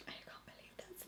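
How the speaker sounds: high awed whisper. Real, not acted. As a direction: breathe it out in disbelief. Awed aside at packaging.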